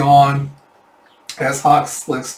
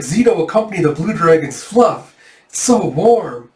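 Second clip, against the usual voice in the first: blissful Zeno; 5 semitones higher, 5 dB louder than usual. Acted. Zeno cuddling fur coat.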